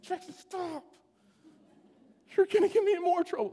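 high, strained urgent hush; urgent plea. Retold or imitated. Dad to celebrating son while mom cries, urgent high plea. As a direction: imitate a desperate high-pitched shushing plea.

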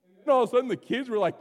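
ordinary talk

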